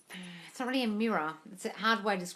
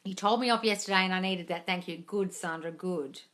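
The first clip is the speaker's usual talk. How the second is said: ordinary talk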